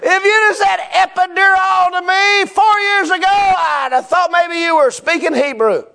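high, loud comic outburst; comic shout. Real, not acted. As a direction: belt it out to the back row. Loud comic exaggeration to congregation.

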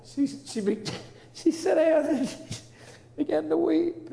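moved high voice. Real, not acted. Moved while telling it.

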